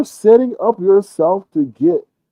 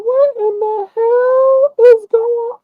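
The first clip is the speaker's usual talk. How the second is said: much higher, slow, whiny disbelief; mock disbelief; slower than usual. His own reaction to the cooing, high and drawn out. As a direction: wail it out in mock shock. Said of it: "I was like".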